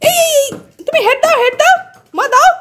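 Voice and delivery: high, sing-song talk to a pet. Real, not acted. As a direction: coax the pet in a high playful voice. Calling pets playfully. (উঁচু গলায় পোষ্যের সঙ্গে আদুরে কথা)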